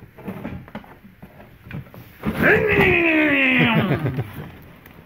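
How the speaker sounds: high, loud revving chainsaw whine; chainsaw impression. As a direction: rev it like a power saw. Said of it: "That was my chainsaw impression".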